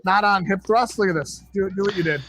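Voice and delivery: high-pitched mock scolding, fussy old man; grumpy old scold. Host mocks the video as a tutting old man. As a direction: scold like a cranky elder tutting at the screen.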